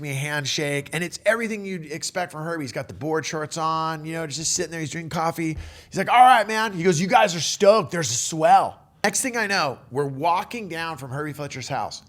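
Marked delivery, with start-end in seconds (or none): 6.1-8.8 hearty greeting. Retold or imitated. Herbie greets the group, retold.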